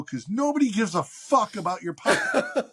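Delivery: high, mocking put-down; mock-contemptuous brush-off; scornful retort. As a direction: sneer it like the final blow. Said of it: "I go".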